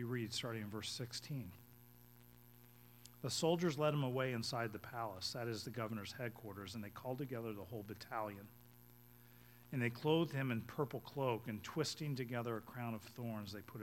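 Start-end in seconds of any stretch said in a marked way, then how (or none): none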